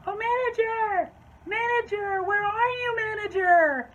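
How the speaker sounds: high, drawn-out mock wail; hammed-up, loud singsong holler; yelling for someone. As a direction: call out as if searching the whole shop. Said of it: "she's just yelling".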